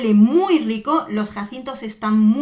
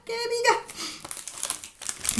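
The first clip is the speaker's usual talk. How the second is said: slow, soft and strained, sneeze held in; about to sneeze; 11 semitones higher, 8 dB quieter, much slower than usual. Real, not acted. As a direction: squeeze it out, holding back a sneeze. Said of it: "Voy a estornudar".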